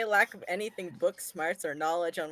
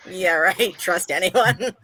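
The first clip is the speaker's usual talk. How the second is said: sarcastic scoff; 6 dB louder, much slower than usual. Retold or imitated. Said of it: "Raven's just like".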